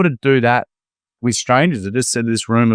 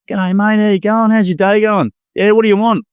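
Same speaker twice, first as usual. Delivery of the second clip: high, bright welcoming greeting, much higher; friendly barista; 11 semitones higher than usual. Retold or imitated. Barista welcoming a customer at the counter, high cheery greeting. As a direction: imitate a cheery café worker greeting you.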